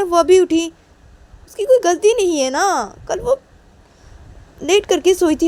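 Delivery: very high, loud, pleading protest; worked-up daughter. Acted. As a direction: plead for your sister at the top of your voice. Tisha defends sister, worked up.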